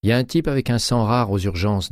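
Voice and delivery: lightly voiced Graziella. A narrator's reading. Graziella explaining to colleague, lightly voiced casual.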